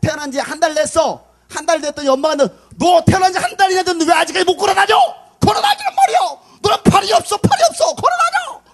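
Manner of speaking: high, loud hammed-up shouting; crazed mother scolding. Mother demanding her month-old baby walk, mock frantic shouting. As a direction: shriek at the baby like an unhinged mother. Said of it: "엄마가 정신이 아니잖아".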